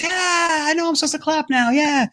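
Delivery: high, bored crowd voice; mock crowd. Host imitating a crowd clapping on cue, lightly high voiced. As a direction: play a dutiful audience cheering on cue. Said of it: "the crowd goes".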